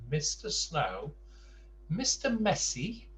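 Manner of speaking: ordinary delivery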